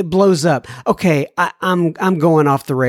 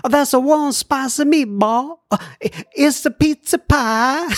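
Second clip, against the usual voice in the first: higher, hammed-up Italian accent; exaggerated fake Italian lilt; mock Italian accent; 9 semitones higher than usual. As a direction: do a cartoonish Italian impression. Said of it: "when we imitate people that are from".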